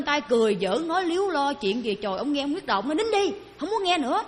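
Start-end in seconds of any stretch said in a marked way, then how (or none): 2.9-4.2 high, sharp order to shut up; angry shout to be silent. Retold or imitated. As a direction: snap out a fed-up command. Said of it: "la vậy đó".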